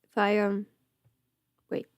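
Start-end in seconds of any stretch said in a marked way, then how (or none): none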